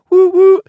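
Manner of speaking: very high, loud whistle woo woo; train whistle woo. Retold or imitated. Kid playing trains, shrill loud whistle. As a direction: hoot it like a toy train.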